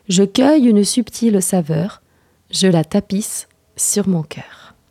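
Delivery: measured verse reading, each couplet falling away; poem recital. Acted. The reader recites their own short poem, poetic recital. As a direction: recite couplets with lift and fall.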